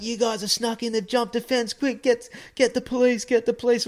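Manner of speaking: high, rising alarm, calling for police; mock frantic security guard yelling for backup; panicking security guard. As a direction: squeal it out like a guard who caught intruders. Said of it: "They're like".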